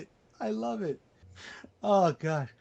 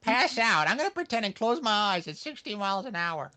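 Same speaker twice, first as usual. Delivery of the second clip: high, playful put-on old lady voice; mock grandma voice; 8 semitones higher than usual. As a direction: voice grandma pretending to faint, jokingly. Voicing grandma's thoughts, playful.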